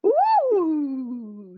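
high delighted fairy squeal; a high excited ooh from the fairy baby; fairy shriek. A narrator's reading. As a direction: squeal like a thrilled imp. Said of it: "shrieks that baby fairy".

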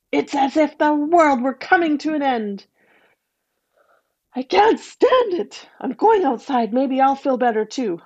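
lightly voiced terrified mother; frightened woman. A narrator's reading. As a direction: say it in rising panic. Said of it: "said the woman".

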